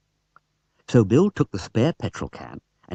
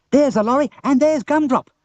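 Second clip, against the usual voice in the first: excited; 13 semitones higher, 5 dB louder than usual. Acted. Bill excited chase.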